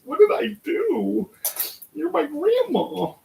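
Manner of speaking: high, slow wounded little voice; mock hurt grandchild. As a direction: play the confused grandchild asking what you did. Hurt at being yelled at by grandma.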